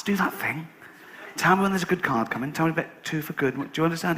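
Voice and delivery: quiet, urgent instructions at the table; hushed urging. Retold or imitated. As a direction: lean in and press him under your breath. Urging Douglas at casino.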